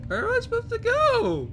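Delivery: high frightened question; squeaky panicked asking. Real, not acted. Lost while fleeing.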